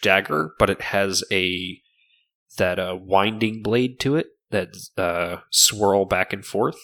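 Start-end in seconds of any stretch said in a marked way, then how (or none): none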